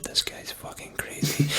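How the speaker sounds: hushed, slow whisper; whispering into mic. Retold or imitated. Sound guy confiding quietly into his mic, slow whisper. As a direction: whisper it under your breath. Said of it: "like, whispering into his mic".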